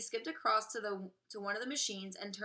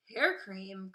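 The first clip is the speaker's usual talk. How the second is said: cried. Acted. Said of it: "cried Mike".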